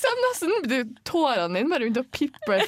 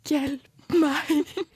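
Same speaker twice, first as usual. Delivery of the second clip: mouthed plea; 6 semitones higher, 4 dB louder than usual. Retold or imitated. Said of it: "med munnen din".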